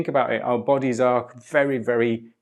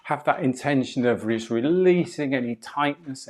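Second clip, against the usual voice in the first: usual speaking voice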